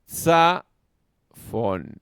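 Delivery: "Tsa ... fon" sounded out in separate syllables; syllable-by-syllable stress demo. Shown as a demonstration. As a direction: belt out "Tsa", then drop to a long "fon". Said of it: "en una sílaba cerrada recibe el acento".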